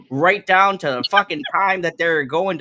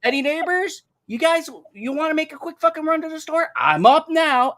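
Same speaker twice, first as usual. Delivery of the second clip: sarcastic mock offer; 10 semitones higher than usual. Real, not acted.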